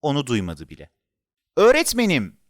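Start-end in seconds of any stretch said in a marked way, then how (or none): 1.6-2.4 high, raised call to the teacher. A narrator's reading. Child calling the teacher who isn't listening, calling out. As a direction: call out to get the teacher's attention. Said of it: "diye yeniden seslendi".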